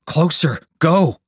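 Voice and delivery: high, loud shouted command; urgent shout. A narrator's reading. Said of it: "Jane shouted to Darcy".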